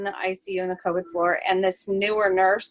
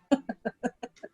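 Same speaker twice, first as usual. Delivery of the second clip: soft laugh; 6 dB quieter than usual. Real, not acted. Laughs after story.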